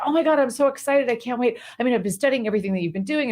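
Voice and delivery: breathless rush of eager excitement; excited young monk. Retold or imitated. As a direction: rattle it off like an eager apprentice who can't stop. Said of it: "he gets so excited".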